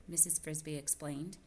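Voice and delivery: usual speaking voice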